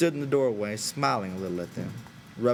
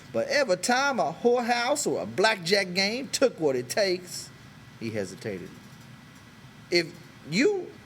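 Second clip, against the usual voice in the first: higher, halting then bolder; hesitant Crooks; 8 semitones higher than usual. A narrator's reading. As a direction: offer himself shyly then with pride.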